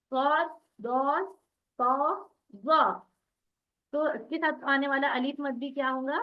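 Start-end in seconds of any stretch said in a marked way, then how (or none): 0.1-2.8 सौद, दौद, सौ, ज़ा with dark rounded vowels, each set apart; name सौद, दौद, सौ, ज़ा heavily with a full "aw"; very thick, raised letters. Shown as a demonstration. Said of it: "बहुत मोटे और ऊंचे कितने लफ्ज है". (सौद, दौद, सौ, ज़ा को मोटा और ऊंचा बोलें)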